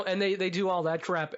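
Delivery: ordinary talk, nothing marked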